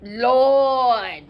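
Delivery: long, drawn-out moan; groaning nurse. Acted. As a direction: wail it as the suffering nurse. Nurse groans over her headache.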